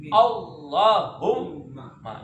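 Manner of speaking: Allahumma, very slow, double l and double m held; drawn-out Allahumma, both doubles stressed; correct doubled letters. Shown as a demonstration. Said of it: "Jangan Allahumma".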